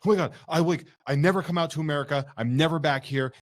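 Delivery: high, tumbling fan excitement; imitating freak-out. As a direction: gush like an overwhelmed fan, retold. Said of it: "He started freaking out".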